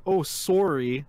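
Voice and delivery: loud, drawn-out "sorry" with exaggerated long O; mock accented "sorry". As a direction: stretch the O of "sorry" slowly, mocking it. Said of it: "the only time they say it".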